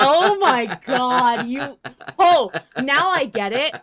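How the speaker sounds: loud, high, drawn-out dawning; sudden realization. Real, not acted. Host finally gets the Doctor Who reference, surprised. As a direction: let it click loudly.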